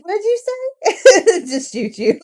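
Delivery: high, loud "what'd you say"; shrill mock menace before tasing; high mock threat. Pretending to be an old lady with a taser, high loud threat. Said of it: "I'll be like".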